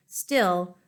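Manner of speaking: ordinary delivery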